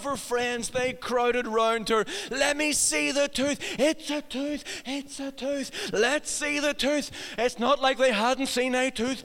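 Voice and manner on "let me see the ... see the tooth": slow, lightly voiced crowd of children; a hint of eager playground kids; lightly mimicked children clamouring to see; excited kids. Friends crowding round the tooth.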